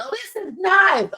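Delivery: very high-pitched, gushing delight; mock admiring coo. Imitating a guest touring the cabin, high-pitched gushing. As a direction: lift it way up, as if impressed.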